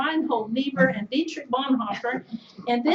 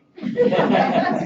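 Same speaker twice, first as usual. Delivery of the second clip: a sudden high breathy laugh; 12 semitones higher, 9 dB louder than usual. Real, not acted. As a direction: burst out laughing at the memory. Laughs at the story.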